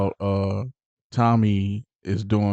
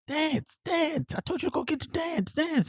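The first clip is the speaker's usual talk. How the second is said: mock mad taunting; 5 dB quieter than usual. Tommy shooting at the kid's feet, high mock-angry taunting. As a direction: jeer orders in a high, imitated voice. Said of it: "he gets mad".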